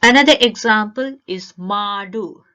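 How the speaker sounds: ordinary talk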